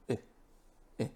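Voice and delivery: a relaxed lifeless "uh"; schwa sound. Shown as a demonstration. Teacher showing the schwa to students, relaxed. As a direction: let "uh" fall out with no effort. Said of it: "you just make the sound".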